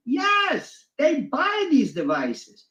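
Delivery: slow, high mock-patient explaining; high sarcastic answer. High, slow mock-patient answer to George Orwell.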